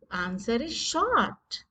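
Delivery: normal talking voice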